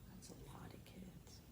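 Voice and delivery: soft unvoiced whisper; hushed aside. Real, not acted. Teacher to children over the picture, whisper. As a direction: share it as a secret. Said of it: "aside to listening children".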